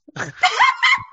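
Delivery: high, loud burst of laughter; real laugh. Real, not acted. Player teasing a friend about bravery, real laugh.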